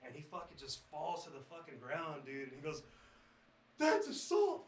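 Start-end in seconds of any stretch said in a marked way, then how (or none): 3.8-4.7 screaming bloody murder. Retold or imitated. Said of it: "starts screaming bloody murder".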